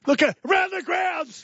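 high, drawn-out shouted intro; high-pitched shout to announce; announcer call-out. Pretend. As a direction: call out the segment title like a fanfare. Calling out a segment title.

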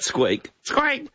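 high squawking cockatoo voice; cockatoo yell. Retold or imitated. Radio guest imitating a cockatoo yelling the mother's nickname, high squawk. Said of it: "cocky not only would yell out".

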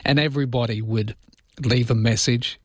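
ordinary talk, nothing marked

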